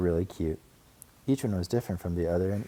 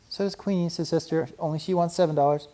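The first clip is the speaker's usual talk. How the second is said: put-on little girl voice; 10 semitones higher, 3 dB louder than usual. A narrator's reading. Sister cub voice.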